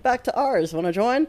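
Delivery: mock Irish, cheerful invite; bad Irish accent. Imitating Irish clubgoers inviting her home, bad Irish accent. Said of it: "that's the worst Irish accent".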